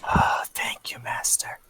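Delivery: slow hissing servant whisper; creepy dragon whisper. Acted.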